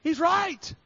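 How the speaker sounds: high mock exclamation, not loud; imitating a woman's surprised outburst; imitated astonished outburst. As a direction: echo her amazed cry up high. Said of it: "she yelled out, out loud".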